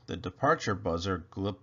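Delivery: usual speaking voice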